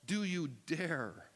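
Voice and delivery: slow, challenging "Do you dare?"; strong accusing question. Shown as a demonstration. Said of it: "It's strong, strong language".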